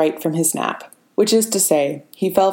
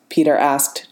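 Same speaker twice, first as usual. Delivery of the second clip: ordinary delivery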